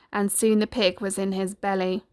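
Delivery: ordinary delivery, nothing marked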